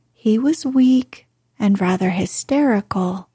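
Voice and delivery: ordinary delivery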